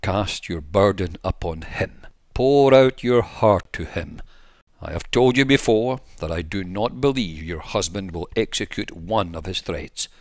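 ordinary delivery, nothing marked

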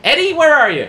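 high stage call to the crowd. Retold or imitated. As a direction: call out for someone lost in the audience. Comic calls plant from stage.

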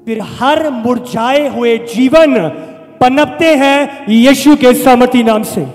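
loud, emphatic sermon declaration; full-force preaching. Real, not acted. Preacher declaring new life in Jesus' name, full-force preaching. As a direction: proclaim it loudly over the congregation.

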